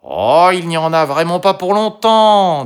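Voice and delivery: high, loud, dismissive coaxing. A narrator's reading. The fox reassures Toutou it won't take long, coaxing. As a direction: swoop up high to brush off the worry. Fox coaxes the reluctant dog.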